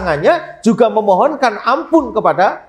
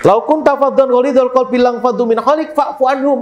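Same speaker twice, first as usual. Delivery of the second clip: Quran recitation; 5 semitones higher than usual. Acted. Recites Quran verse again.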